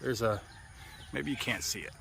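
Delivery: normal talking voice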